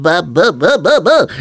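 high, loud infant babbling; piping, repeated baby syllables; baby babble. A narrator's reading.

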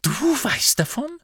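very high, outraged question; outraged disbelief. A narrator's reading. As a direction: pitch shoots up in indignant shock. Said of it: "einer empörten Miene".

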